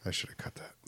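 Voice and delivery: breathy whisper, no voice. Real, not acted. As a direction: whisper it to yourself. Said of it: "I'll be quiet".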